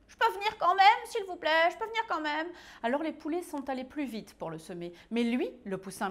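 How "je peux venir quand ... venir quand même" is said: very high, loud, fast chick voice; little chick begging. Acted. The tiny chick begs the warrior chickens to come along. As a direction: beg like an eager tiny chick.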